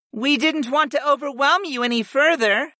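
very high-pitched goddess voice; goddess chiming in. A narrator's reading. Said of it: "Fi chimed in".

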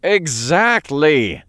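slow, weary groan; drawn-out fed-up agreement; groaned exactly. A narrator's reading. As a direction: let it out with tired exasperation. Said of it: "Raphael groaned".

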